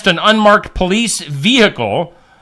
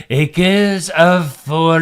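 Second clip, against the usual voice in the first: put-on French accent; much slower than usual. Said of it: "I like how you go in and out of it".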